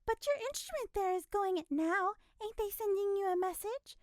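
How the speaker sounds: put-on high, loud insistent old woman; nosy woman, relentless; relentless busybody. A narrator's reading. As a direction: keep pestering her. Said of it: "relentless owner of the nose".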